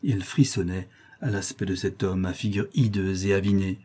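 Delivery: ordinary delivery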